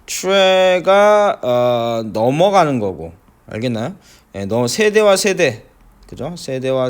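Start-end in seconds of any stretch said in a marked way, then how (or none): 0.0-1.4 트레가 drawn out long; slow stretched syllable. Shown as a demonstration. 선생님이 단어 첫 음절을 길게 늘이기. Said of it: "traditional 하면".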